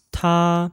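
他 high and level; first tone. Shown as a demonstration. Teacher models 他 for repetition, high first tone. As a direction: hold 他 on one high, even pitch. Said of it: "with a first tone".

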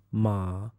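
MA dips low and soft. Shown as a demonstration. Teacher demonstrates Chinese sounds, low dipping tone. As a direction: sink MA to the bottom of the voice. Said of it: "if I use the Chinese sounds".

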